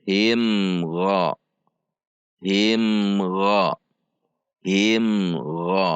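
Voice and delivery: "Him Gha" joined, the m clearly voiced with a brief hum; clear izhar. Shown as a demonstration. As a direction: join "Him" to "Gha" with no bounce. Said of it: "dibaca izhar dengan sifat bainiyah". ("Him Gha" dibaca izhar)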